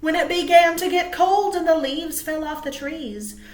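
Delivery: usual speaking voice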